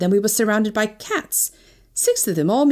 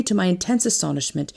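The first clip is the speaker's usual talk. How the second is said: ordinary delivery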